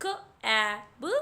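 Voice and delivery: म ए न, each sound split apart and slow; sounding out letters. Shown as a demonstration. Teacher sounding out a word for children, slow and split. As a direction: stretch म ए न into separate sounds. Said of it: "तो यहाँ पर क एट".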